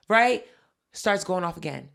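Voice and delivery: ordinary talk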